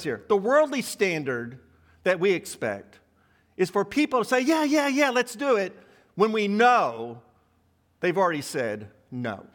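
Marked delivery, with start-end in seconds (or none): 4.4-5.8 higher, mock-keen chorus of yeses; glib agreement. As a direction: imitate someone agreeing too readily. Acts out people falsely agreeing.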